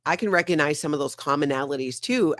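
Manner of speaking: ordinary talk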